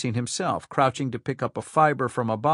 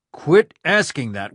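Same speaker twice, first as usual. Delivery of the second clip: slow, louder warning; irritated; 3 semitones higher, 7 dB louder, slower than usual. Acted. Rhyme tells his aide to stop asking, irritated. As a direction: drag the order out slowly.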